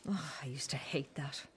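bitter whisper. Acted. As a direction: hiss it under your breath. Bitter aside to daughter.

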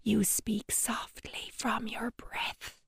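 slow, soundless whisper; soft breathy whisper, slowly; whisper demo. Shown as a demonstration. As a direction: show them how a whisper sounds. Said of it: "When you whisper".

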